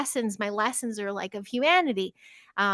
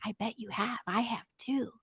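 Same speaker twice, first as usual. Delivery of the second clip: put-on softer, conspiratorial reply to children; hushed, confiding; 8 dB quieter than usual. Teacher softly confiding to two little girls about fairies.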